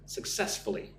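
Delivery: Successfully said softly, stress on the second syllable cess. Shown as a demonstration. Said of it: "Put the emphasis on the second syllable".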